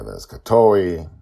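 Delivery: ordinary delivery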